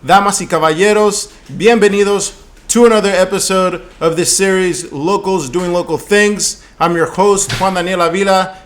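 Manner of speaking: booming show-opening announcer voice; lucha libre announcer. Acted. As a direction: announce it like a ring MC. Podcast host opening the show.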